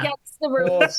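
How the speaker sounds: northern accent from Leeds. Shown as a demonstration. Contestant completing the quote for teammates, in a Leeds accent. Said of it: "say this word in a Leeds accent".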